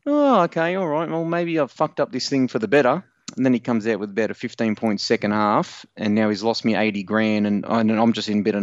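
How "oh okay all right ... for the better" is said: high opening easing down into cautious hope; mock self-reassurance, starting high; tentative relief. [name] replays his half-time thought to Corey, tentatively relieved.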